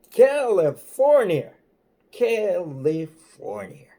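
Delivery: loud, slow, high "California" dragged out; drawn-out trooper read. Retold or imitated. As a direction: savor each syllable like a suspicious cop. Trooper reads the license aloud.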